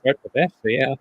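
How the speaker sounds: muffled, badly picked up mic. Real, not acted. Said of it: "you're kind of, uh, muffled".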